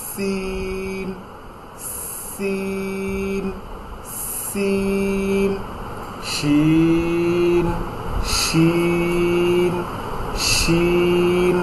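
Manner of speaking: সিন hissed long and soft; soft drawn-out sin. Shown as a demonstration. Teacher showing students sin and shin, slow and soft. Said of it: "একটা sound হবে স".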